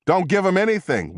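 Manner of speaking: high-pitched, urgent warning; barked order. A narrator's reading. Medic to investigator over the patient, mild bark. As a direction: snap it out, pitched well up. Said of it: "one of the medics barked at me".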